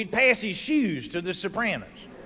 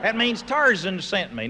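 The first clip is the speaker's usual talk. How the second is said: ordinary delivery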